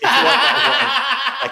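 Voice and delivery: laughing. Real, not acted. Laughing at own mistake.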